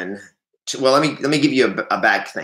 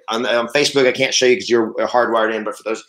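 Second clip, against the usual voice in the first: ordinary talk, nothing marked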